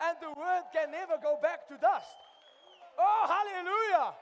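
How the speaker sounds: rising to a loud, high preaching cry; exultant shout. Real, not acted. Preacher erupts in praise to the congregation, loud and high.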